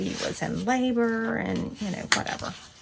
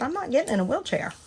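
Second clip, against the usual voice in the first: firmer stubborn refusal; 7 semitones higher, 3 dB louder, faster than usual. Retold or imitated. Refusing nurse again.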